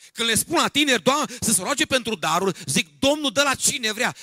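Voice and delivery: loud, high, impassioned pulpit voice; high-pitched shouted plea from the pulpit; full-force preaching. Acted. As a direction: cry it out like a preacher acting a prayer. Preaches, acting out Moses' prayer.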